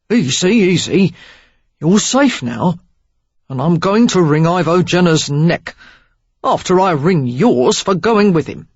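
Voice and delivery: gentle then gruff threat; soothing then threatening. A narrator's reading. Derek calming Sarah, then threatening Jenner.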